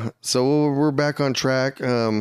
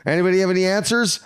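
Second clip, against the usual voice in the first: mock demanding; 7 semitones higher, faster than usual. Mocking friend's meal counting.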